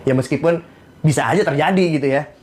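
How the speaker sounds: ordinary talk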